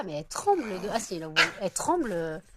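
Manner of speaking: normal talking voice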